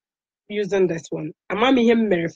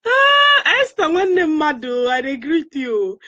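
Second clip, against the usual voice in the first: high, loud cry easing down into hello; exclaimed greeting; 8 semitones higher, 4 dB louder, slower than usual. Real, not acted. Host greets Esther, a viewer, with a high exclaimed hello. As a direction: call out a high, drawn greeting.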